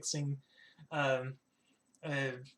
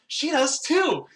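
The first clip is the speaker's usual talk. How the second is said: high, loud shout; excited accusation; 11 semitones higher, 11 dB louder, much slower than usual. Acted. Chisadri points at the other woman, excited shout. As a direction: yell it out like a tattling accusation.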